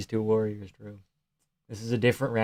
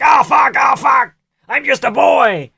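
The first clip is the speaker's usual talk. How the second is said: high, loud mock-panicked yelling; squealing mock terror, much higher and louder; 13 semitones higher, 9 dB louder, slower than usual. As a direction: ham up a frightened fighter crying out. Panicked fighter voiced in retelling.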